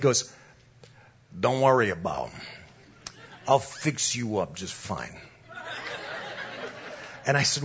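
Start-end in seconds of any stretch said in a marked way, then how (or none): 1.4-5.2 low, soft wiseguy assurance; mafia tough guy. Retold or imitated. Mobster waves away the pastor's doubts, low wiseguy voice. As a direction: promise it like a mob fixer. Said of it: "he says it just like this".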